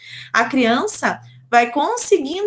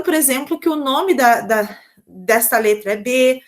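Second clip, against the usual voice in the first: ordinary delivery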